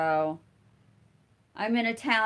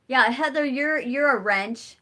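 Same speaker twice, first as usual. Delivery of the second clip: ordinary talk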